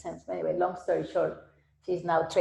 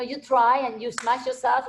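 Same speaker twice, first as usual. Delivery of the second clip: ordinary talk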